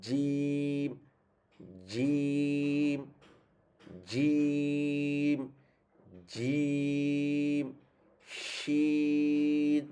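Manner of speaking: jim and shin held very long; sustained Arabic letter recitation; drawn-out letter names. Shown as a demonstration. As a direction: stretch জিম and শিন out slowly like a tajweed teacher. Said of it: "এই বরাবরটা উপরে লাগাবেন।".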